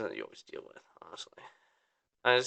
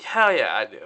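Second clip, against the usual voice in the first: eager agreement; 6 dB louder than usual. Retold or imitated. Quoted eager reply to drink offer.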